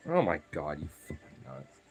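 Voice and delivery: usual speaking voice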